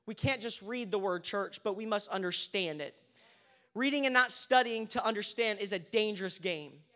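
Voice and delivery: ordinary delivery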